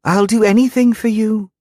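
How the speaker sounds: put-on high, eager girlish voice; a devoted girl, keen to please; eager young girl voice. A narrator's reading.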